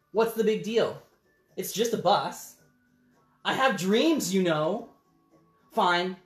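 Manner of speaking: a bird in a huff; sulky pigeon. Acted. Pigeon sulks.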